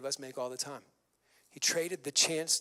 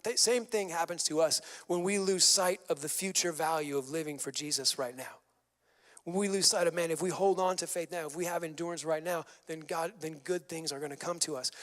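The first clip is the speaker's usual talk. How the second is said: ordinary talk, nothing marked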